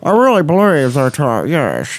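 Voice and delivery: lightly put-on Long Island Lockjaw, jaw held tight; mock Lockjaw accent. Host keeping up the snobby bit, mild Lockjaw accent. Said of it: "Long Island Lockjaw is the name of that".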